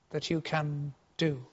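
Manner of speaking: ordinary talk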